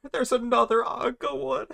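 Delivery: high-pitched squeal through laughter; cracking up laughing. Real, not acted. Streamer spotting another naughty avatar, cracking up. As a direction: burst out laughing mid-line, voice leaping up.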